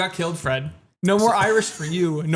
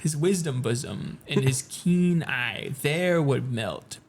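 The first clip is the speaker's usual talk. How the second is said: very slow, low poetry reading; Nevermore-style gloomy verse, drawn out; slow, deep recitation; 3 semitones lower, 3 dB quieter, much slower than usual. Acted. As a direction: draw each line out deeply and solemnly. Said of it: "That was you just speaking slowly and deeply".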